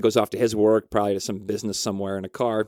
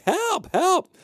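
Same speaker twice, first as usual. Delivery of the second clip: high-pitched mock cry for help; mock scream. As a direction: call out help in a thin, high pretend scream. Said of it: "The mom's in the window screaming".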